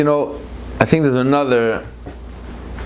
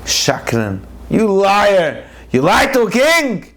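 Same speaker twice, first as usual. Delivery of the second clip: a king thundering at a liar; furious lion king; 7 semitones higher, slower than usual. Acted. Lion king condemning the wolf as a liar, furious.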